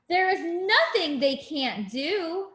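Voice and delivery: normal talking voice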